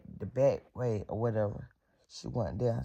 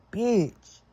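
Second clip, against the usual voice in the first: high, strained pleading-threat; pitched-up retold argument; heated warning; 10 semitones higher, 3 dB louder than usual. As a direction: tell her off while catching your breath. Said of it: "I'm steady telling her".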